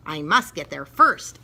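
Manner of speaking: usual speaking voice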